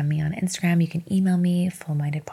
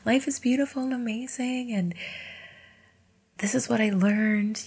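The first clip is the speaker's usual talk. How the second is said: dreamy high future-me announcement; imagined future self; 8 semitones higher, 4 dB quieter than usual. Pretend. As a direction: gush like it's all over and fine. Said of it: "be like".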